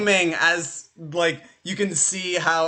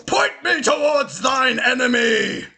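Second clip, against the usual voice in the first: high, loud Viking king battle cry; booming yell; 8 semitones higher, 4 dB louder than usual. Acted. Angry Viking king bursts through the door, yelling. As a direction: bellow it like an angry warrior king.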